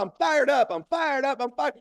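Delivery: very high rapid-fire chant; hyping up. Retold or imitated. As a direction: re-enact chanting to beat fear. Repeating the hype phrase.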